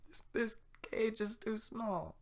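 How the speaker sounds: put-on very high, slow child bear; little bear crying. Little bear complains about the cage, high and tearful. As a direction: whimper like a little cub. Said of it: "little bear crying".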